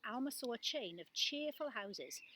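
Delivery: ordinary delivery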